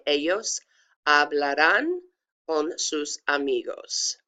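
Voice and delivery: careful Spanish "ellos hablarán con sus amigos", in separated pieces; pronunciation demo. Shown as a demonstration. Teacher modelling the last sentence, careful demo. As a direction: double L of "ellos" as a Y, silent h in "hablarán". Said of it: "it sounds like a Y".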